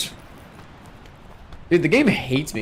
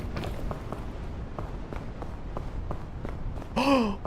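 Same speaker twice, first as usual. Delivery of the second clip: sharp gasp; 11 semitones higher, 10 dB quieter than usual. Real, not acted. Spots something in the game.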